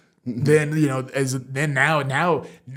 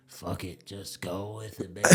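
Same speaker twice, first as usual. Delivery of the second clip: resigned self-talk; 5 semitones lower, 10 dB quieter than usual. Retold or imitated. His inner voice before hostile crowd.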